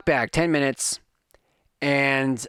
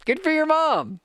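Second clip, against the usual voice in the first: mock praise; 12 semitones higher than usual. Host mocks in high voice.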